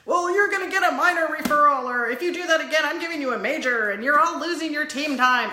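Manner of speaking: loud, high imitation of a scolding school staffer; mock yelling at kids. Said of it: "just have to yell at kids".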